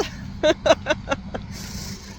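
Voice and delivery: high unvoiced laugh; breathy laugh. Real, not acted. Mom laughing at her son's words, airy. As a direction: let out a soft airy laugh.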